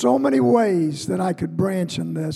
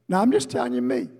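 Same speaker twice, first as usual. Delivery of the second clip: normal talking voice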